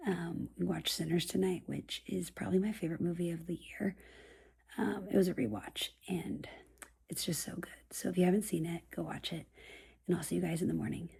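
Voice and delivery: soft late-night whisper. Real, not acted. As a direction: whisper so nobody wakes. Late-night whispered vlog outro.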